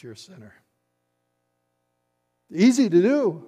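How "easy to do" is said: high, booming sing-out of "Easy"; sudden forceful burst; loud exclamation. Shown as a demonstration. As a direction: throw it out big and high. Sets easy against very hard.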